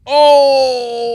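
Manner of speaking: loud, high, drawn-out "oh"; shocked reaction. Real, not acted. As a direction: cry out at the outrage of it. Reacts to burger mix-up.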